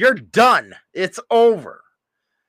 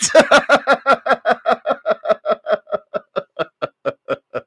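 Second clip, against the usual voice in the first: laugh; 4 semitones higher than usual. Real, not acted. Laughing at own joke.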